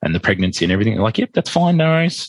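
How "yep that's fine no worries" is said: high, light, easygoing reply; breezy agreement. Retold or imitated. Hospital staff reassuring the couple, breezy agreement. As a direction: toss it off cheerfully higher, imitating staff.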